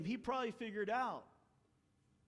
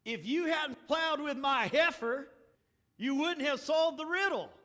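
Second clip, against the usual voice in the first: higher, louder taunting retort; mock angry retort; 6 semitones higher, 8 dB louder than usual. Samson snapping at the men, mock angry. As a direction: fling it back like an insult. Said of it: "now hear this one".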